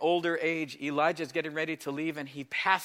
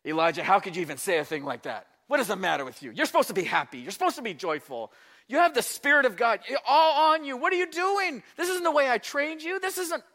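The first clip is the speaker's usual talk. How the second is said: acted telling-off climbing higher and louder; mock scolding; 8 semitones higher, 5 dB louder than usual. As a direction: scold him, building to high exasperation. Imagined God scolding Elijah.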